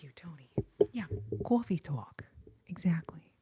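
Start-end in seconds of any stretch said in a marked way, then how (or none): 1.4-2.2 "coffee talk" with New York-area rounded vowels, cwoffee; New York-area accent. Acted. Streamer agreeing with a viewer about the sketch, New York-area accent. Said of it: "line taken from that Coffee Talk sketch".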